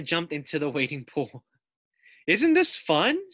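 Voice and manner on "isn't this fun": high, slow, loud delight; delighted girl. A narrator's reading. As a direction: gush with joy. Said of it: "she said".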